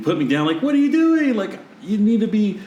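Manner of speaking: put-on high indignant baby voice; high squeaky mock-baby complaint; baby's protest. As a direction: voice the baby outraged at being put down. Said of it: "Camden was like".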